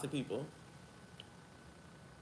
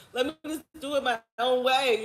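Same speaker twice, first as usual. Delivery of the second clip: laughing retort; 8 semitones higher, 5 dB louder than usual. Retold or imitated. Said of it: "I'm like".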